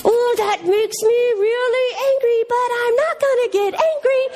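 very high, strained cartoon hero; hammed-up struggle to hold back anger; Larry Boy. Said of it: "And he's like".

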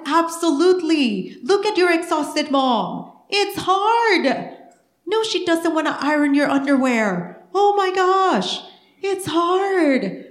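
high, emphatic preacher's delivery; impassioned preaching. Real, not acted. Preaches at full force.

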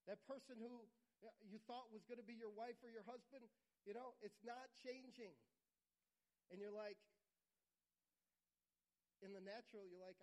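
ordinary talk

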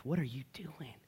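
breathy whisper climbing to a high, soft disbelief; muttering under breath. Retold or imitated. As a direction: mutter to God in soft, incredulous amazement. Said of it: "mutter under my breath".

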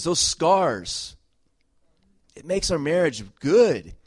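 loud, high, building sermon cry; full-force preaching. Acted. Pastor preaching to his church, loud and fervent. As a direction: preach it out to the congregation with fire.

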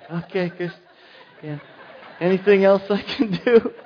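overly obliging servant tone; mock patient husband. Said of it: "Mark, would you mind".

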